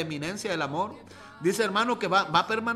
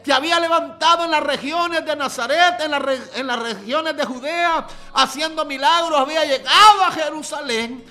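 higher, much louder fervent preaching, building to shouts; preaching at full force; 7 semitones higher, 9 dB louder than usual. Acted. Preacher shouts the blind man's hope to the congregation, fervent. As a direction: proclaim it like a revival preacher at full cry.

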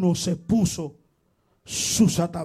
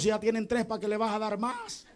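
ordinary talk, nothing marked

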